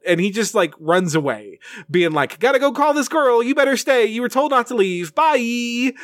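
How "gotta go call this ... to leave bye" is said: high, tossed-off goodbye on the run; breezy brush-off. Retold or imitated. Dan calling back to Boy as he runs off, breezy brush-off. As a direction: mimic someone calling back while running away. Quote of Dan running away.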